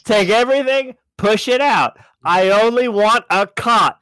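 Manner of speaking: high, loud, emphatic commands; shouted orders. Acted. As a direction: call out orders to clear the place. Acting out emptying the castle.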